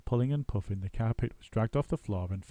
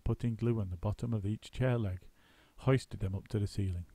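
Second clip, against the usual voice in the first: plain reading voice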